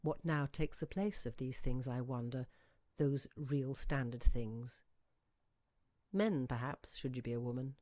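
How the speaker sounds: usual speaking voice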